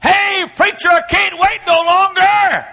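high, loud holler across the church. Retold or imitated. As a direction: yell it from the back row, desperate to come forward. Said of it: "hollered at me".

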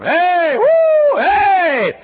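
high, loud football cheers; stadium yelling. Retold or imitated. Fan yelling at the high school football game, very loud and high. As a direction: whoop like a fan in the stands. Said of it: "I was yelling up a storm".

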